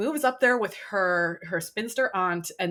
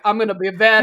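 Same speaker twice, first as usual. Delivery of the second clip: loud, playful Scottish brogue; 7 dB louder, faster than usual. Acted. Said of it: "Oh, yeah, just a brogue".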